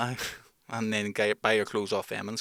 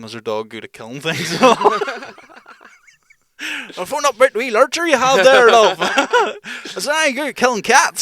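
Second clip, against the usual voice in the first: much higher, loud put-on voice; mock prank caller; 12 semitones higher, 13 dB louder than usual. Prank caller asks a dog seller about killing cats, high and loud. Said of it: "ask 'em".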